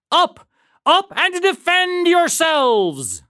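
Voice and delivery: high, loud rallying shout; alarm cry. A narrator's reading. Paul Revere's call as the farmers hear it, shouted alarm. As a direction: call it out urgently, stretching the ending. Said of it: "they understood the cry".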